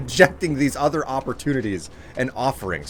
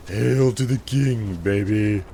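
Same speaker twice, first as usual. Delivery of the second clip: slow, drawn-out action-hero catchphrase; Duke Nukem; 2 semitones lower, slower than usual. Acted. Streamer doing a requested impersonation, slow tough-guy voice. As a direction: draw it out like a cocky game hero. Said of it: "A Duke Nukem impersonation".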